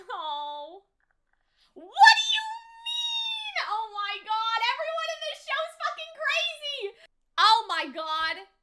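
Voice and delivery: ordinary delivery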